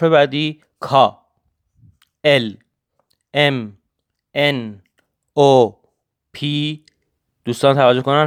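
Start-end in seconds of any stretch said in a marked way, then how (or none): none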